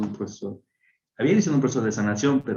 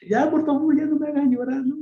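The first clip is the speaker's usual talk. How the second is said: pleading quote; 3 dB louder than usual. Retold or imitated. Begging to stop crying.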